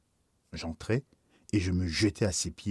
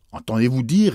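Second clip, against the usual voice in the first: louder, affronted challenge; indignant; 3 semitones higher, 8 dB louder than usual. Acted. Bishop indignant at being accused of simony, louder. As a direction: bristle at the accusation. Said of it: "répliqua l'évêque avec indignation".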